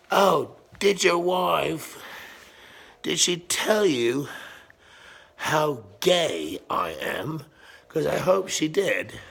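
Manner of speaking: high, slow mock-innocent question; gloating taunt. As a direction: draw it out to needle the husband. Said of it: "run into him at the grocery store and say".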